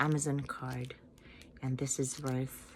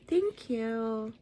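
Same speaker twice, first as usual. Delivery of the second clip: ordinary talk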